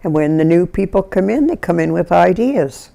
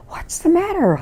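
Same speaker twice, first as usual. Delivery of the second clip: high-pitched mock-alarmed question; retold alarm; 12 semitones higher, 4 dB quieter than usual. Neighbour running over to the crying family, alarmed. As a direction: re-enact an urgent, high cry of concern.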